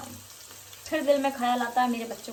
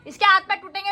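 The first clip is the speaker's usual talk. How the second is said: loud, high warning; warning shout; 8 semitones higher, 8 dB louder than usual. Real, not acted. Mother shouting a warning at the kids. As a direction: call out to stop them.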